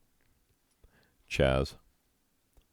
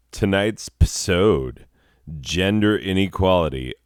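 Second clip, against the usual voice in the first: louder announcer read; mild announcer tone; 2 semitones higher, 5 dB louder than usual. Acted. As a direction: present the topic like a host. Announcing the episode topic.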